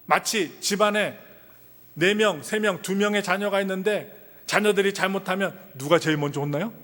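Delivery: normal talking voice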